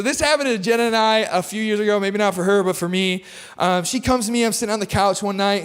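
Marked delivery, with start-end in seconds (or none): none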